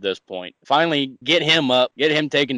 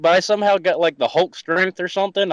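ordinary talk, nothing marked

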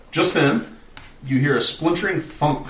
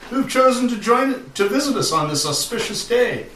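high, loud pompous ruler; pompous lord; 11 semitones higher, 5 dB louder than usual. Acted. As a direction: proclaim like a jolly self-important jarl. GM voices the jarl's speech.

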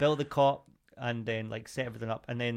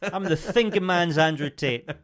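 Andrew Tate impression. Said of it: "quite a good impression".

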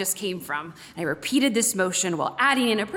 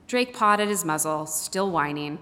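ordinary delivery

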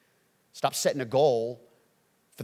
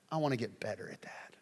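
softer whispered confession; 4 semitones lower, 10 dB quieter than usual. Acted. Humble believer confessing to himself, whisper. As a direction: breathe it out privately.

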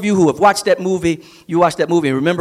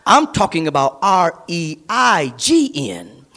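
ordinary delivery

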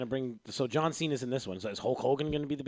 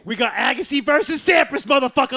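loud, high hype-man shout; hammed-up sports hype, yelling it out; hyped announcer; 10 semitones higher, 14 dB louder than usual. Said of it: "Guy was like".

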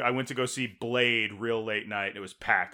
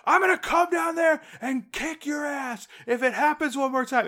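high, shouted threat in imitation; mock drunken scream; 13 semitones higher than usual. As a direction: rant like a furious drunk standing up. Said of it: "And he starts screaming".

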